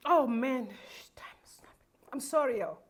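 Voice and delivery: real tears, barely getting the words out; emotional breakdown. Real, not acted. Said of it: "I'm gonna be emotional".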